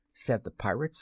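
usual speaking voice

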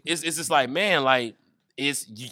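usual speaking voice

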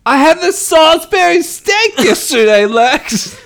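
high, put-on Lionel voice with a laugh; laughing through a put-on high Lionel voice; mock Lionel. Lionel teasing Lex, high and laughing.

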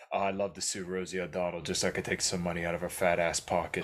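a low, soft put-on Trump voice; Trump impression. Host recalling Trump's interview jab, mock Trump voice. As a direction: mumble it like a lightly voiced Trump. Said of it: "I remember".

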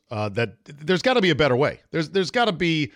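normal talking voice

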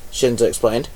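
plain reading voice

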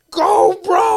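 loud excited yell. Retold or imitated. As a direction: shout it like a drunk fan. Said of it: "yelling like".

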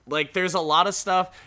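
normal talking voice

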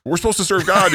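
high, loud retold outburst; excited proclamation. New convert running round telling people, excited proclamation. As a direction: proclaim it loudly, mimicking his own zeal.

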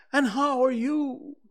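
a friendly, eager little bear; cheerful bear Pooh. Acted.

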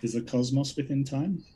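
faint question from away from the mic; far from the microphone. Real, not acted. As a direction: ask it from too far off the mic. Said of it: "the microphone was so far away".

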